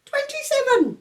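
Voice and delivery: put-on a severe baby laying down the law; stern baby George.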